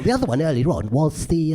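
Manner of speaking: ordinary delivery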